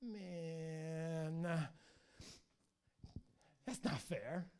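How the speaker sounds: drawn-out sulky complaint; whining. Retold or imitated. Satan complaining to God about Job, whining. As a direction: grovel and whine like a thwarted child. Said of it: "Satan grovels and he whines to God".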